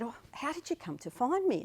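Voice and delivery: a lightly mimicked older woman asking; imitating the aunt. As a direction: play the curious aunt on the phone. Retold phone call with aunt.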